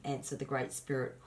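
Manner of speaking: usual speaking voice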